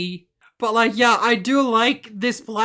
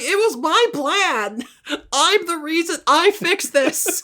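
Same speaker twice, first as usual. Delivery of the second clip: imitated indignant protest; 7 semitones higher than usual. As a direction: protest in her place, pitched up. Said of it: "And she gets mad".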